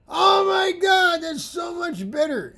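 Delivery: high, loud, slow shout of delight; student thrilled by the result. Retold or imitated. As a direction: shout it out, amazed. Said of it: "this is what I heard".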